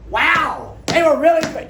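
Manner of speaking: very high, loud, wide-eyed gushing; gushing teammate. Retold or imitated. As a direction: gush with oblivious delight, pitched high.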